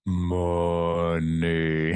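low, drawn-out money; money dropped low and stretched long; deep money. Acted. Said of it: "The new word is money".

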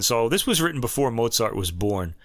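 ordinary talk

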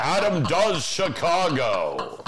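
slow dramatic title read; movie trailer voice. Pretend. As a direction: announce it like a porn title. Movie title announcer bit.

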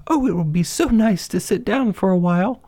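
put-on high-pitched storybook girl, wishful; high character voice, daydreaming; Goldilocks, longing.

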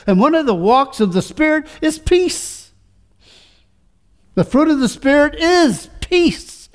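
high, ringing preacher's build; full-force preaching. Acted. Pastor urging the congregation about peace, preaching at full force. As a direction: preach it out to the back pews.

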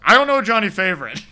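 high loud defensive denial; mock defensive blurt. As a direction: blurt it out guiltily. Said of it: "It's like".

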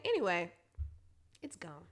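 ordinary talk, nothing marked

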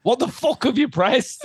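high, drawn-out accusing alarm; panicked. Retold or imitated. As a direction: demand answers in a comic panic. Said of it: "it was a moment of panic".

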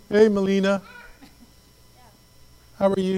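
guy greeting her. Retold or imitated. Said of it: "He came".

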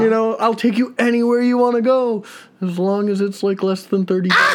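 high, eager pleading offer; pleading apology. Retold or imitated. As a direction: sweet-talk her into dinner. Apologizing to angry girlfriend.

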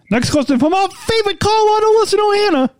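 very high announcer voice; radio voice. Pretend. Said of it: "my radio voice?".

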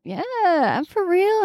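high, slow, playful answer; her own cheerful reply. Retold or imitated. Sister answering the amazed driver, high and slow. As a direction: tease him sweetly. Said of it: "And I was like".

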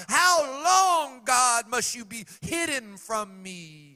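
loud, high, slow lament; King David crying out in anguish, loud. Retold or imitated. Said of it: "David's cry repeated".

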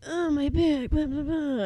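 high-pitched mock complaint; mock smarmy whining. Retelling shot brother moaning about his back, mock smarmy whine. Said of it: "Drake, who's very smarmy".

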